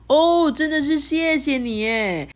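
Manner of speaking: high, loud, drawn-out delighted thanks; happy monkey. A narrator's reading. Monkey thanks his new guide, happily. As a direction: gush with gratitude, stretching the words. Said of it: "高兴地说".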